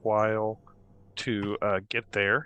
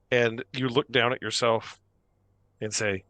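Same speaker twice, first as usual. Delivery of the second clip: normal talking voice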